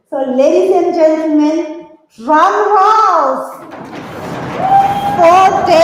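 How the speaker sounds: loud rising MC hype; drum roll build-up. Real, not acted. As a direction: build it up like a drumroll. MC builds to winner reveal.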